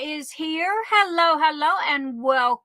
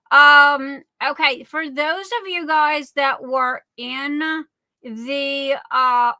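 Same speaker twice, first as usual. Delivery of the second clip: normal talking voice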